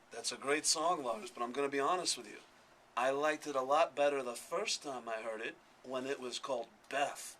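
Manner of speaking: lightly voiced rock star, low and measured; a hint of a low, deadpan celebrity impression. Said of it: "and a fake [name]".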